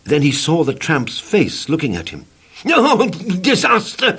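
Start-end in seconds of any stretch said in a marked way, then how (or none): 2.6-4.2 high, drawn-out wail of disaster; comic terrified scream. Acted. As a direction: wail it in terror. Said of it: "he screamed".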